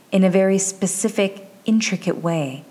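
ordinary delivery, nothing marked

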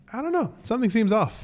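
high-pitched, lightly imitated friend; doubtful friend. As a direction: pitch it up, acting uneasy. Voices friend's doubtful report.

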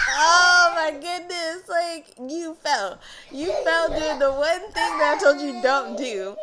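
loud, high, drawn-out mock dismay; exasperated parent. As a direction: scold loudly with a laugh underneath. Acts out exasperated reaction to fall.

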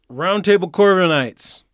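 loud, high call to arms; mock rallying cry. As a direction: call out the team like heroes assembling.

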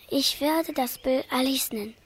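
soft whisper. Acted. Painter naming his portrait after the girl, whispering. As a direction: murmur it to yourself tenderly.

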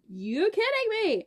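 high-pitched, incredulous retort; indignant disbelief. Retold or imitated. Girl retelling her comeback to the ex-boyfriend, indignant disbelief. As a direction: throw it back in mock-outraged disbelief.

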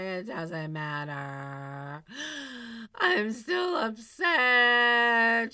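slow, drawn-out, gasping and then loud; mock upset gasp. Insisting to friends at the diner she is upset, hammed-up. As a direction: overplay being hurt. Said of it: "And I was like".

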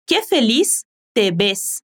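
"Qué feliz te ves" spoken slowly, each word laid out; slow model phrase. Shown as a demonstration. Teacher modelling the phrase slowly for learners to repeat.

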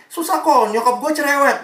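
high-pitched mock teenage grumble; complaining teen. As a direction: imitate a teen griping in exasperation. Voicing teen's last complaint about mother.

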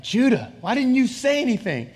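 re-enacted exasperated father; acted exasperation. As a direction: plead with the child, pitch jumping up. Exasperated father finds hiding son.

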